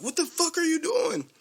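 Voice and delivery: high mock yell from the dad; angry dad. Dad shoving Earn away from his son, high mock outrage. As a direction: squeal it like an outraged father. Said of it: "the dad, like, pushes him".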